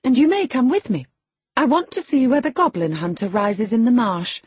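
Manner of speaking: high, loud bossy chatter turning impatient; imperious young girl. A narrator's reading. Girl hurrying the lad for her horse, bossy high voice. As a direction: order him along like a little mistress.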